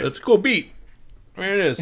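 high, goofy voice of a casual music listener; clueless music fan. Retold or imitated. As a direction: play a guy bobbing along to a beat. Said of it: "That's me listening to music".

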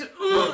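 high, loud groan of disgust. Retold or imitated. Disgusted ugh at the pushy taxi driver, loud and high. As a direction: let out a loud grossed-out ugh. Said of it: "And I just went".

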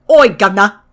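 mock Cockney "Oi, governor"; Cockney customs agent impression; Cockney accent. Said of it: "is straight up Cockney".

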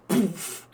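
playful mouth-made poof; imitated impact. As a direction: mimic the puff of a punch landing. Voicing an imagined punch's impact.

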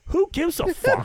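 high incredulous scoff; mock dismissive yell. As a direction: squeak it out like who cares. Retold dismissive bathroom reply.

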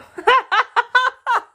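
high burst of laughter; loud laugh. Real, not acted. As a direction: cackle out loud. Laughs at the fanfic.

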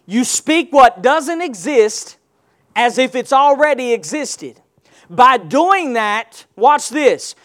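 slow, building preacher cadence; preaching at full force; rising preaching. Acted. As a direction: drive it home, gathering force.

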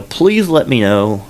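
usual speaking voice

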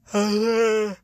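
loud, high, drawn-out weary groan; real worn-out groaning sigh; tired groan. Real, not acted. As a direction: let out a big exhausted sigh with full voice. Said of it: "I'm really tired".

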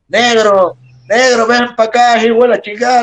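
loud, very high holler after someone; imitated irate promoter bellowing; angry calling shout. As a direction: yell furiously at a man walking away. Promoter calling after departing wrestler.

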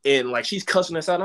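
normal talking voice

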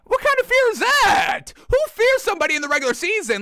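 high, squeaky disbelief; incredulous. Real, not acted. Mocking the friend's claim.

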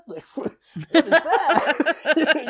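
high, loud amazed disbelief with a laugh; laughing, stunned question to the recruiter; amazed what. Retold or imitated. Recruiter pitches school plus army, amazed reply.

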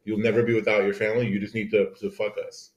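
ordinary talk, nothing marked

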